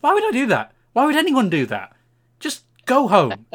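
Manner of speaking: loud, high, disbelieving outburst; ranting about the receipt, loud and high; exasperated rant. Real, not acted. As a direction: vent the outrage at full tilt.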